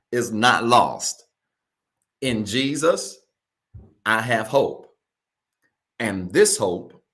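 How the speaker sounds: measured, emphatic call-and-repeat; slow, paused declaration. Real, not acted. Pastor leading viewers to repeat after him, slow emphatic declaration. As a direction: declare it phrase by phrase, pausing for the echo. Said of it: "read it slow and pause".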